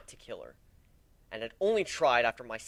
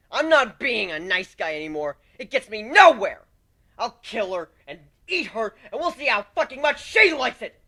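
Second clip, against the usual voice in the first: high, loud vengeful ranting; unhinged villain rant building to a shout; murderous rage; 11 semitones higher, 13 dB louder than usual. Acted. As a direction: spit out revenge plans in fury.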